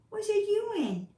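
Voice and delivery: high questioning pet voice; pet talk. Real, not acted. Owner asking her cat what it's doing, high pet voice.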